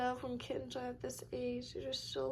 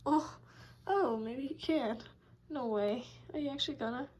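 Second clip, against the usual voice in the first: loud, astonished exclaim; louder, amazed disbelief; surprised; 6 dB louder than usual. Real, not acted. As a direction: caught off guard as the kitten climbs. Kitten starts climbing out.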